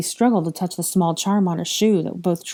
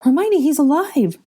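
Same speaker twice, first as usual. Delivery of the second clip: high, loud, urgent relief; excited cry, building; shouting; 9 semitones higher, 6 dB louder than usual. Acted. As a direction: shout the good news to a friend. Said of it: "Ginny shouted".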